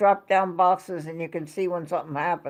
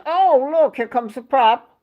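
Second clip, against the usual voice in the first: much higher, louder "Oh, look", staying lifted; surprised call-out; 4 semitones higher, 4 dB louder than usual. Retold or imitated. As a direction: mimic a sudden excited discovery. Retelling Eric spotting the prop.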